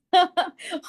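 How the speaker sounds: short, high-pitched happy laugh. Real, not acted. Recalling crying with the father on that call, happy laugh. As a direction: burst into a brief high laugh.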